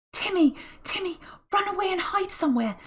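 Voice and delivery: put-on high hushed girl's voice; high urgent girl; girl's urgent whisper. A narrator's reading. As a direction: send the dog off in a whisper. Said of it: "whispered George suddenly".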